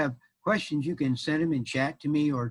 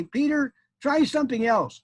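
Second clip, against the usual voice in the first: high, urging put-on wife voice; exasperated wife; 12 semitones higher, 4 dB louder than usual. Wife urges Peter to eat differently, high-voiced exasperation. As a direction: plead with him in a high voice.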